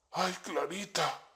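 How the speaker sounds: soft, wistful murmur. A narrator's reading. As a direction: breathe the name under your breath. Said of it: "murmura Apolodoro".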